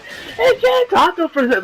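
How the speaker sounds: high, quick imitated request; mock an eager, high-pitched ask. Goku asks the bodyguards to let him talk, imitated high eagerness.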